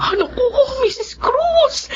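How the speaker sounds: high, choked with tears and a laugh; laughing through tears of relief; tearful gratitude. Acted. Mother thanks the social worker, crying and laughing.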